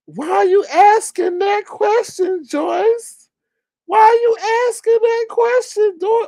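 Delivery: very high squeaky mocking voice; Daffy Duck. Panelist scolds Joyce for asking, squeaky mocking impression. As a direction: squeal the question in falsetto, stretched out. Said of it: "here comes Daffy Duck".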